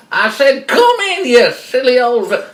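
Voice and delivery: loud falsetto order; high granny disguise losing its temper; impatient fake grandma. Wolf ordering the child in, irritated mock-grandma.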